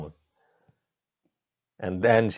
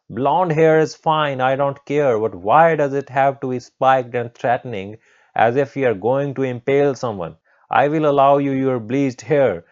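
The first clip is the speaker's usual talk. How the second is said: loud, raised-pitch lecture; scolding teacher getting more worked up; shrieking teacher; 5 semitones higher, 6 dB louder than usual. Acted. New teacher tells a student off about bleached hair, shrieking lecture. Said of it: "started shrieking".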